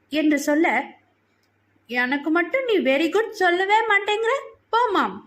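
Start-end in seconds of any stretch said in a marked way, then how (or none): 1.9-5.2 put-on high little-girl protest; higher-pitched childish sulk; complaining child. A narrator's reading. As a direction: whine at mummy, feeling left out.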